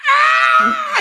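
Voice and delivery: loud, very high mock scream; imitated scream. Retelling the kid getting bitten again, imitated piercing scream. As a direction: shriek like the bitten little kid. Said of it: "And he just screams it out".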